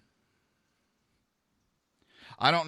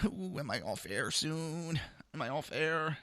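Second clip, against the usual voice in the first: lightly giddy ooh, higher pitched; acted-out giddiness; 6 semitones higher, 4 dB quieter than usual. Sitting here hoping to go off air, mock giddy. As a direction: imitate your own giddy anticipation. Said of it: "getting all giddy going".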